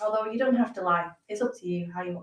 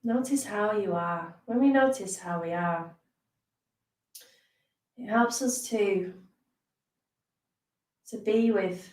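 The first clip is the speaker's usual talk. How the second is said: unhurried meditation teacher, leaving space between phrases; guided meditation. Acted. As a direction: ease listeners into rest, phrase by phrase. Leads a guided body scan.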